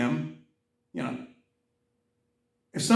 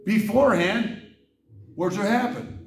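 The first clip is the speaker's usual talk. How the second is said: angry reread; 5 semitones higher, 4 dB louder than usual. Real, not acted. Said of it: "I get very angry sometimes".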